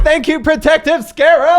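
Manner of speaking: high, loud cry of joy; ecstatic thanks. Real, not acted. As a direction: cheer the scarab. Player thanking his item ecstatically.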